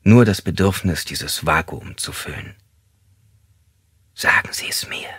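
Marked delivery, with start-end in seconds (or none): 4.1-5.1 soft, voiceless whispered urging. Acted. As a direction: whisper it to the suspect. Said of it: "flüsterte sie".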